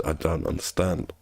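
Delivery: usual speaking voice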